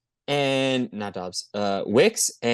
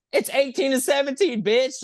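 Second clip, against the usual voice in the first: taunting high voice; 13 semitones higher than usual. Retold or imitated.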